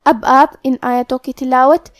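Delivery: ordinary talk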